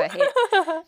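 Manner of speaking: high mock anguish; mock despair. Real, not acted. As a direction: whine it dramatically. Reacts to aging.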